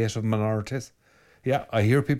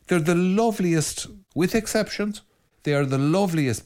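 fond praise; 8 semitones higher than usual. Real, not acted.

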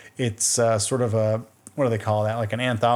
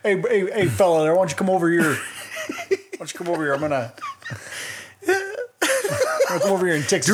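higher-pitched Texan yokel, hammed-up; mock Texas accent; 6 semitones higher than usual. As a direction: call someone over in a silly Texas twang. Mock Texan chainsaw killer skit.

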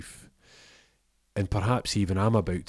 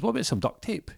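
much higher, falsely sweet question; [name] asks for duct tape, faux innocent; mock-innocent ask; 13 semitones higher than usual. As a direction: ask sweetly like it's nothing.

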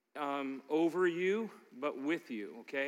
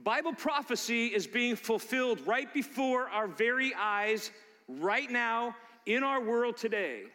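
preaching; 5 semitones higher than usual. Real, not acted. Sermon rises to prophecy warning.

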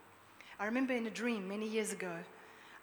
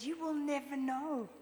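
higher, slow taunting reply; defiant taunt; 5 semitones higher than usual. Retold or imitated. Preacher answers Satan in the dream, gleeful defiance. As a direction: savour each word mockingly.

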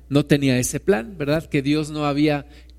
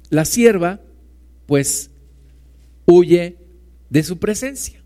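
ordinary talk, nothing marked

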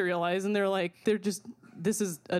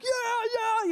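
much higher crowd cheer; mock cheering; 14 semitones higher than usual. Crowd cheering the ringleader, high mock cheer. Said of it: "everyone's like".

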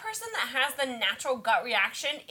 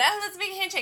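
mock cutesy suggestion; 8 semitones higher, faster than usual. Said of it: "and then".

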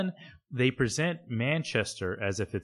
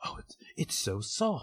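Frasier touching the fabric; 4 semitones higher, 7 dB quieter than usual. Retold or imitated. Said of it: "and he's like".

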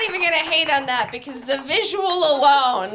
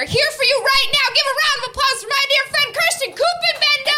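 big intro; 11 semitones higher than usual. Real, not acted. Host bringing next comic on, high hype. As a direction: sell the next act big.

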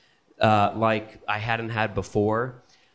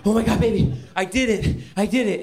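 high, loud, thrilled shout of news; giddy high-pitched announcement; excited; 10 semitones higher, 4 dB louder, faster than usual. Retold or imitated. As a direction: burst out with the big news. Excited call to wife after kill.

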